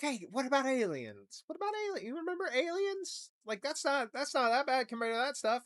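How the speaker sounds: high, hushed put-on voice; mock deflecting official. Officials distracting the public with aliens, high hushed put-on voice. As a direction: squeak it out like a nervous spin doctor changing the subject. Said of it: "They're like".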